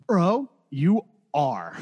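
slow, drawn-out mock reply to a friend; deadpan slow quip. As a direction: let each word hang, mock-serious. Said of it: "You're like".

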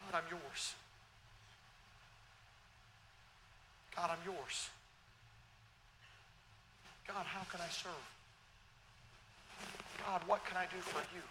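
soft, humble, kneeling prayer; quiet humble prayer. Acted. Preacher voicing Isaiah kneeling before God, soft and humble. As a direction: breathe it out like a man on his knees. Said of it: "fall on his knees before God".

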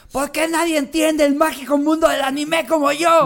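berrinche agudo y muy fuerte; grito otaku exagerado. Acted. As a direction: grita como otaku incomprendida, con drama exagerado. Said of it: "empezó a gritar". (chillido dramático de anime)

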